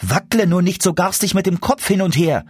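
put-on high child's voice, a touch afraid; anxious little boy. A narrator's reading. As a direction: call out as a worried small boy. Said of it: "rief der Kleine ängstlich". (ängstlich rufender Junge, hohe Stimme)

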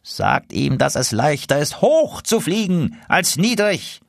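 a hint of an old lead goose, slowed; lightly voiced old goose, unbothered; lead goose advice. Lead goose calling back advice, lightly voiced. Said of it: "rief die Führergans".

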